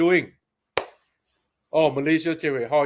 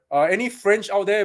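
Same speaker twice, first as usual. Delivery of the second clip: ordinary talk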